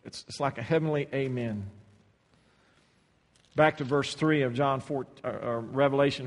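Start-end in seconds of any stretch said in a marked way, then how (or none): none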